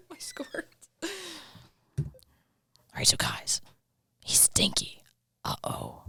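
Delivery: hushed whisper, almost no voice. Real, not acted. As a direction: whisper so he won't hear. Hushed aside while someone returns.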